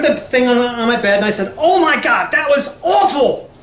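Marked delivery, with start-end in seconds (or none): 1.6-3.5 high-pitched mock dismay; retold appalled outburst. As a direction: exclaim in acted horror, pitch jumping up. Retold private outburst after hearing song.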